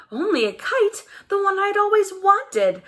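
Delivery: high, rising, delighted; a child delighted to find the kite; thrilled surprise. Acted. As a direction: let it burst out with joy.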